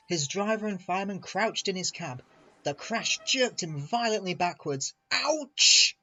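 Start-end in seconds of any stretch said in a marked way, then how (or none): 5.1-6.0 high, loud cry of shock; shivering yelp. A narrator's reading. Engine crashed under cold water, shivering yelp. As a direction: yelp it as icy water hits. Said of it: "he shivered".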